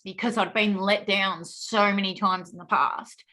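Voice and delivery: usual speaking voice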